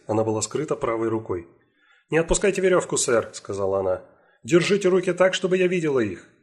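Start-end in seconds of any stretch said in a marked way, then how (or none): 2.1-6.3 firm police command. Acted. Police orders at gunpoint.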